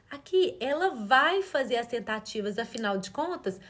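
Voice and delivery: ordinary talk, nothing marked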